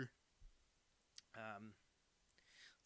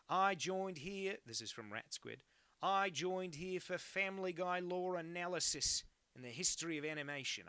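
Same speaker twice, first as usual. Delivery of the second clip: high, loud mock Aussie read; Australian accent; 6 semitones higher, 6 dB louder than usual. Chat read in put-on Australian voice.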